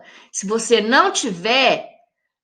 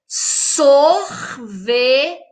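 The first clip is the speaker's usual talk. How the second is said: "sorveteria" broken into five slow, separate syllables; much slower than usual. Shown as a demonstration. Teacher showing pupils five syllables, "sorveteria" spaced out slowly. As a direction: leave a gap after each syllable of "sorveteria". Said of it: "Essa palavra possui cinco sílabas".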